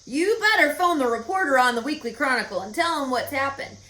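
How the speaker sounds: thrilled farmer urging his wife to phone; excited farmer. A narrator's reading. Proud farmer tells wife to call.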